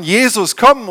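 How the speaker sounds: lauter, hoher Ruf nach Jesus; gespielter Schrei. Retold or imitated. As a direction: ruf verzweifelt nach ihm. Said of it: "Und dann brülle ich ihn an". (gespielter lauter Ruf)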